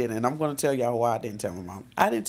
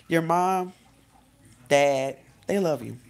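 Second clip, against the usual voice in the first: ordinary talk, nothing marked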